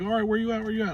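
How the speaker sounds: lightly mocking buyer. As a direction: nag like an impatient buyer. Imitating impatient buyers.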